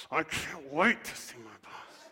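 slow, dragged-out "I can't wait to see my boss" with stress on wait; reluctant, grudging. Shown as a demonstration. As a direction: force the words out unwillingly. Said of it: "Some of you might have to say it like this".

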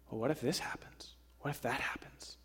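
fretful whisper, lying awake at night; worried whisper. Retold or imitated. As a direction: breathe the worries out unvoiced. Sleepless fearful whisper.

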